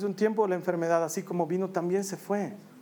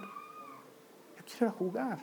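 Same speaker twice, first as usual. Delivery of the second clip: much softer, slow, higher and breaking; fading tearful plea; 4 semitones higher, 10 dB quieter, much slower than usual. Retold or imitated. Father echoing his daughter's last plea before the needle, fading and tearful. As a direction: let the child's plea die into tears.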